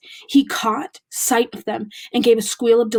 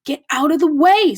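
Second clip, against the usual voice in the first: loud sharp snap; snapped; 6 semitones higher, 7 dB louder than usual. A narrator's reading. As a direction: snap it at him. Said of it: "snapped Ron".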